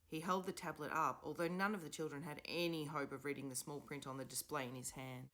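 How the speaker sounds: usual speaking voice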